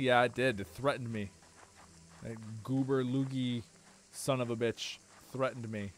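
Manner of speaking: ordinary talk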